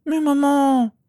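put-on very high, loud little-girl protest; whining child. A narrator's reading. Daughter protesting bedtime to her mother, high whine. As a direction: wail it like a child refusing bed.

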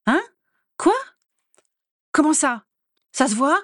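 high, startled questions; rising alarm, pitched well up; panicked. A narrator's reading. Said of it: "s'affola Andromeda". (affolée, voix qui monte)

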